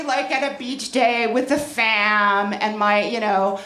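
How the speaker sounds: ordinary talk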